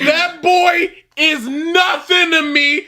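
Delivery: high shouted boast, slow; mock villain boast. Co-host playing Voldemort boasting to the crowd. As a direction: boast of the kill. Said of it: "joining the Voldemort impression".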